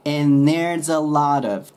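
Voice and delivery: slow, "lot of" run together; slow linked demo. Shown as a demonstration. Teacher modelling linked sounds slowly. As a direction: draw it out, linking each word. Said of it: "くっつけてください".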